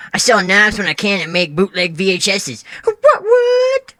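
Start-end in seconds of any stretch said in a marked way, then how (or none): 2.8-3.9 sudden high squeak on both words; squeaky catchphrase. Acted. Odd guest squeaking his catchphrase at the host, high. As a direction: yelp it up high like a cartoon.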